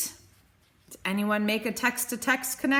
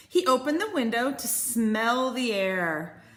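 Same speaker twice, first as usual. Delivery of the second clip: plain reading voice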